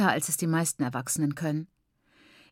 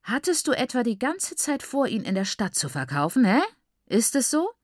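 mocking, pitched higher, with a needling hä; hurtful sarcasm; 6 semitones higher, 3 dB louder than usual. A narrator's reading. Father taunting daughter about the city, cutting sarcasm. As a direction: sneer the question at her. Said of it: "verletzend sarkastisch".

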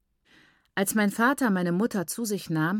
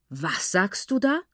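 slow, disbelieving question; can't believe what he just heard; incredulous. A narrator's reading. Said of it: "fragte er ungläubig".

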